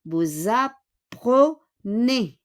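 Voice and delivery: very slow "Vous apprenez" with S as Z; teacher's syllable-by-syllable "Vous apprenez"; Z liaison. Shown as a demonstration. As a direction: stretch "Vous apprenez" into vou-zapprenez. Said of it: "magiging Z".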